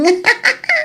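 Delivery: high excited giggle; shy giddy giggle. Acted. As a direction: giggle like a girl with a crush. Giggles about future son-in-law.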